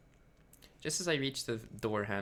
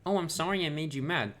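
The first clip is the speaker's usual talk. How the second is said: mocking fake apology; 6 semitones higher, 5 dB louder than usual. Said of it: "apologize to me for just".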